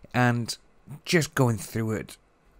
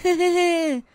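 laugh. Real, not acted. Laugh after mocking her voice.

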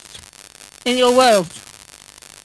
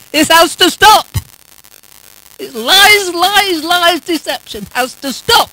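fervent zealot intensity. Real, not acted. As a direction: raise the voice against the lies. Climax of denouncing lies in prayer.

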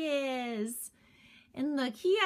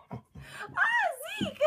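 laughing shriek at the dog; laughing shout; 13 semitones higher than usual. Real, not acted. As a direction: call out the dog's name in surprise. Dog grabs the toy.

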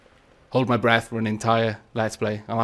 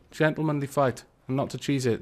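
usual speaking voice

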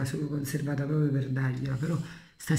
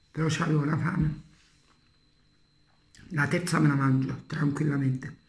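talking with food in mouth; speaking between bites; chewing. Real, not acted. Seen 5: Bit crab claw earlier; mouth shows chewing movements between spoken phrases.

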